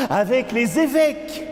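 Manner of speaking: fast, high, excited declamation; quick high-pitched burst of recitation; urgent litany. Acted. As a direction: rush it out high between refrains. Verse rushed between refrains.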